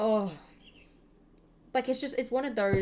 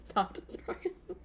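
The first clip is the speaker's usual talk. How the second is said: mock plea; 6 dB quieter than usual. Whispered plea after laugh.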